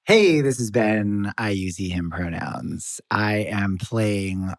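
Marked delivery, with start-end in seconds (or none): none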